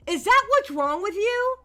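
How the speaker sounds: usual speaking voice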